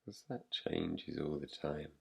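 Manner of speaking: soft, slightly low voice with long pauses; guided meditation. Real, not acted. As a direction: guide them gently into rest, leaving long silences. Leads a yoga nidra practice.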